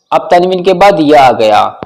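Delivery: normal talking voice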